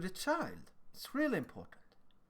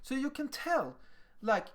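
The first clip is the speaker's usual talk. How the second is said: ordinary talk, nothing marked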